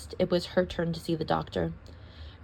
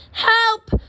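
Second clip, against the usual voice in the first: call for help; 13 semitones higher, 7 dB louder than usual. A narrator's reading. Calling out for help.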